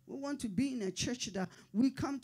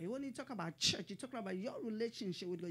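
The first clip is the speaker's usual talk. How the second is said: ordinary delivery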